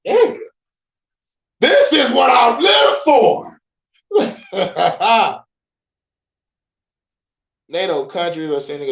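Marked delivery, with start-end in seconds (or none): 0.1-5.4 high, loud, slow excited shout breaking into a laugh; hyped shout. Real, not acted. Reacting to news of fighter jets, a hyped shout and laugh. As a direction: bellow it with pure glee.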